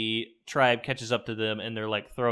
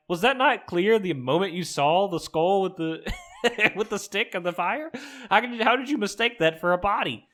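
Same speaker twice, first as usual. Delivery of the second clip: incredulous; 7 semitones higher than usual. Retold or imitated. Speaker's incredulous imagined retort.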